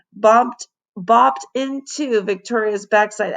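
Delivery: ordinary delivery, nothing marked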